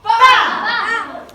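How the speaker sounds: loud high punchy shout. Acted. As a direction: hit it like a comic-book punch. Hero strikes villain.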